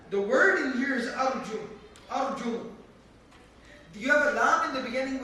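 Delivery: normal talking voice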